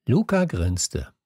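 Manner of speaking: usual speaking voice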